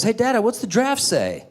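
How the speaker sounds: put-on high, eager little-kid voice; young son. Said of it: "my son goes".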